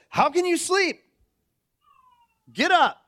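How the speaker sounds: high, loud rousing call; captain waking Jonah. Acted. Captain shouting down at sleeping Jonah, high and loud.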